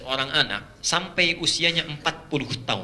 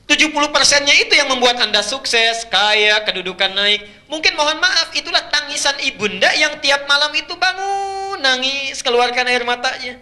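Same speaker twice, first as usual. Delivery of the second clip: much higher and louder, fervent preaching; impassioned preaching; 10 semitones higher, 6 dB louder than usual. Acted. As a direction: drive it home to the crowd with fervor. Preacher at full force.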